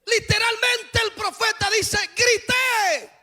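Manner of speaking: climbing sermon shout; fiery preaching. Acted. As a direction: preach it out to the whole church at full force. Preacher rises to full force.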